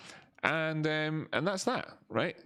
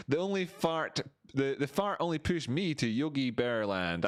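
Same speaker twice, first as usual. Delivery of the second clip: usual speaking voice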